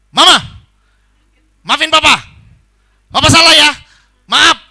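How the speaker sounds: husband yelling sorry at his wife, very loud and high; unfriendly, full-volume mock apology; harsh shouted apology. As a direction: bark out the apology as if scolding her. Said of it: "Terlalu keras".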